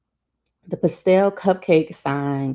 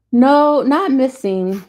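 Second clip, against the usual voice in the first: loud, high, drawn-out "no"; emphatic denial; 9 semitones higher, 7 dB louder, slower than usual. A narrator's reading. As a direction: deny it hastily, pitched well up. Hastily denying a neighbour's suggestion.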